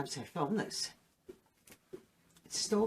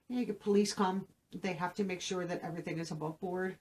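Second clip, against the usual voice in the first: ordinary talk, nothing marked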